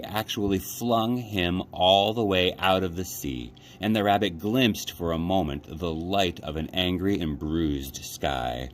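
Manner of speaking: plain reading voice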